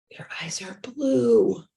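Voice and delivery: slow, half-whispered wonder. Retold or imitated. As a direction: whisper it slowly, drawing out the last word. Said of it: "because when we whisper".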